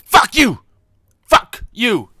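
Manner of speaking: loud repeated shouted curse; retold yelling. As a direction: bellow it like an angry customer. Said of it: "after screaming".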